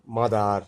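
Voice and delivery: মাদার said with an open ah ending, loud; teacher models মাদার clearly with ah; ah sound. Shown as a demonstration. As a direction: demo of মাদার with ah vowel. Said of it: "ইয়ের উচ্চারণটা আয়ের মতো হবে".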